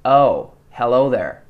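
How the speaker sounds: slow American accent, "oh" from further back. Shown as a demonstration. Coach showing the American way, back-placed American oh. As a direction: draw out an American "Oh, hello". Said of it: "the American oh kind of comes from further back".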